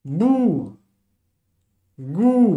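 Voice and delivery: "Bou" then "Gou", each called out high and loud; modelled syllables. Shown as a demonstration. Teacher drills beginners on the syllables, calling them high and loud. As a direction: hit each syllable like a flashcard. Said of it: "on lit comment".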